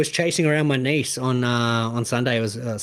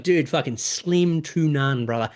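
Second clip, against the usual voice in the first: slow drawn-out refusal; slower than usual. Retold or imitated. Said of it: "I'm like".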